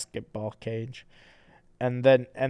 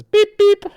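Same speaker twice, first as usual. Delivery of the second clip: high squeaky honk; 16 dB louder than usual. Acted. The cab honks.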